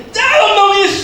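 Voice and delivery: hammed-up casting-out shriek; screamed exorcism. Next exorcist joins in on possessed person, yelling. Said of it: "sampai teriak-teriak".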